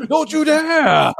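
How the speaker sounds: very high, stretched-out loud yell; mock yell. Lineman yelling in the center's face, long high mock yell. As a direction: holler it slowly, blasting the other player. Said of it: "Just yell in the face of the center".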